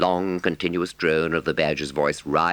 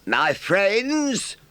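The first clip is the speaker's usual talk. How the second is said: badger speaking. A narrator's reading. Badger voice, much higher.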